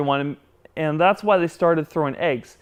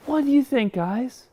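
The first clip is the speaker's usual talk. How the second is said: ordinary delivery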